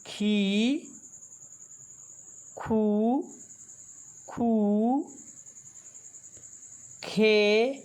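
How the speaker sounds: slow, deliberate recitation of each syllable; teacher reciting the barahkhadi chart one syllable at a time; syllable drill. Shown as a demonstration. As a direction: call out each sound clearly for the class to repeat.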